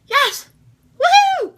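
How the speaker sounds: very high, very loud cheering whoop; nervous burst of a triumphant shout; real shout. Real, not acted. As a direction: whoop it out like you just won. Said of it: "So I'm, like, shouting things".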